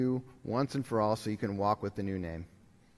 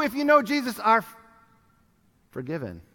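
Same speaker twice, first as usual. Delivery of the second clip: ordinary talk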